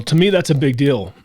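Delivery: holding back tears on a big deal; real emotion rising in the voice; emotional. Real, not acted. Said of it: "I'm getting emotional".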